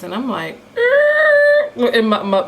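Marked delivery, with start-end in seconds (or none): 0.8-1.9 high, loud cartoon-dog whimper; a startled Scooby-Doo yelp. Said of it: "my Scooby-Doo voice".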